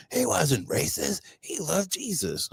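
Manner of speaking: high, soft mock voice of a smug believer; mocking whine. Mocking people who hide behind Christianity, high sneering whine. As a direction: parody their excuse with a sneer.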